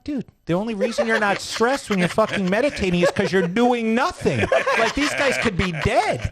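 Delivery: mock outrage breaking into laughter, slowing at the end; telling off a friend while cracking up; laughing retold rant. Retold rant at meditating guy.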